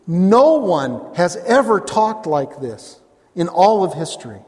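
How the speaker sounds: building, emphatic preacher's cadence; fervent preaching. Acted. Pastor pressing the congregation on Jesus' words, in fervent preaching. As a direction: drive each phrase home, slowed on key lines.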